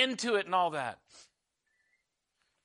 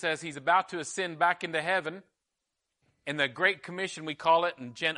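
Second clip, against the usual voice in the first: usual speaking voice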